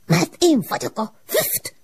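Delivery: high, slow, irritated spirit voice; angry smoke voice. A narrator's reading. The smoke answers the girl's question crossly. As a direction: snap back, strange and cross. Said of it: "felelte dühösen".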